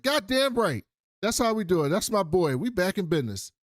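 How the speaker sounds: high, loud triumphant cheer; hearty approval. Retold or imitated. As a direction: celebrate like a proud mentor. Imitating Otto's approving cheer.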